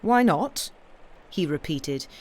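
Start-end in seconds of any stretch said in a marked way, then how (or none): 0.0-0.7 slightly mocking. A narrator's reading. Officer echoing his lover's request, slightly mocking. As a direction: echo her words back, higher and louder, with light mockery. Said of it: "with a slightly mocking accent".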